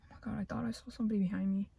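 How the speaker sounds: fast unvoiced whisper; hushed aside. Real, not acted. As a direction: whisper it quickly as if someone is behind you. Startled aside mid-story.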